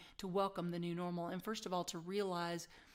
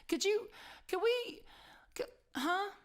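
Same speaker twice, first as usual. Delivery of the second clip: much higher, louder halting disbelief; baffled stammer; 12 semitones higher, 3 dB louder than usual. Retold or imitated. Speaker reacting to the Mickey Mouse remark, baffled stammer. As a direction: stumble over it in re-enacted shock.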